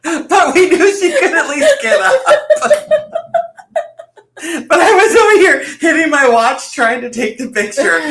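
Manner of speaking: high, loud talking through laughter; laughing hard. Real, not acted. Retelling the friend's handstand fall, high and laughing. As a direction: tell it while cracking up.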